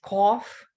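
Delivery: "Cough" with an open aw; teacher models "Cough" with aw; aw vowel. Shown as a demonstration. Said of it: "you can definitely hear the difference".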